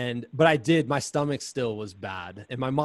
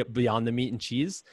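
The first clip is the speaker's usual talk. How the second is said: usual speaking voice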